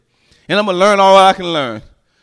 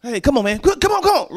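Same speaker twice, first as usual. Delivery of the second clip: pleading men; 7 semitones higher than usual. Retold or imitated. Men pressing the preacher.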